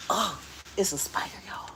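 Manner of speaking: hushed, breathless whisper; whispering as she notices a spider in the tent. Real, not acted. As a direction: whisper it, scared of being heard.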